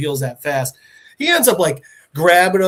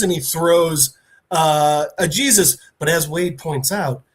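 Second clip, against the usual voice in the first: ordinary talk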